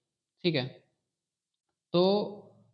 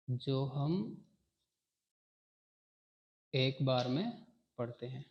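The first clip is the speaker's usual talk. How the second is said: soft repeat; 3 semitones lower, 6 dB quieter than usual. Shown as a demonstration. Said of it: "repeats definition".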